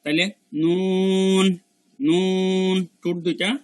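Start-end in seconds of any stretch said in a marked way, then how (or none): 0.6-2.8 নুন slow, long rounded u and held n; rounded nun. Shown as a demonstration. Teacher showing lip shape, long rounded nun. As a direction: round the lips and stretch নুন. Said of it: "ঠোঁট দুইটা গোল হয়ে যাবে".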